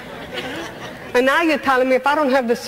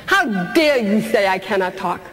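defiant outrage; 6 semitones higher than usual. Retold or imitated. Confronting fate for every limit, building outrage. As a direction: rise in fury line by line.

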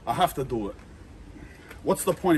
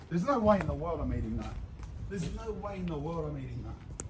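trailing off, quieter and quieter; fading refusal; 10 dB quieter, faster than usual. Real, not acted. Refusing the rotten fish, fading to a murmur. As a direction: let the refusal die away.

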